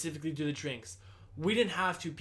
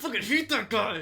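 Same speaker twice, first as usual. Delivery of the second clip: loud, raised-pitch imitation of a fed-up coworker; hammed-up exasperation; mock angry venting; 6 semitones higher, 4 dB louder than usual. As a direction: vent like you just got home furious, played for laughs. Imitating Andrew coming home fed up.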